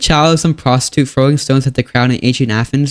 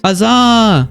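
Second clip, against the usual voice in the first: bored huzzah cheer; 8 semitones higher than usual. Acted. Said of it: "Medieval wars in reality".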